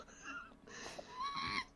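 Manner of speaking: high laugh through real tears; crying and laughing at once; tearful laugh. Real, not acted. Player apologising for crying mid-scene, tearful laugh. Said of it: "I'm actually crying right now".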